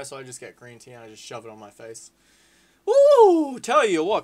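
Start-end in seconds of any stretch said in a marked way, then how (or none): 2.9-4.2 high, loud whoop of relief; whoop, then exclaim loudly; thrilled spectator outburst; excited whoop. Real, not acted. Reacting to a goal-line clearance.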